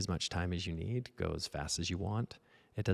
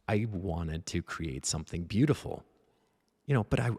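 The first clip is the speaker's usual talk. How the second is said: ordinary talk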